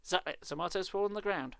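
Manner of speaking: usual speaking voice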